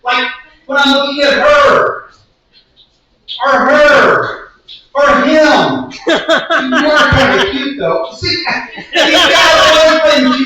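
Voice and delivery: high, exasperated rant with comic emphasis; comic performance poem. Acted. Tired lover tells Cupid to stop doing this, exasperated comic rant. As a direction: plead and scold, playing for laughs.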